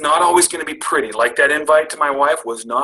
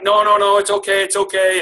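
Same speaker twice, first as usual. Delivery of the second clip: nervous backpedal; 7 semitones higher, 4 dB louder than usual. Retold or imitated. Nervously declining again.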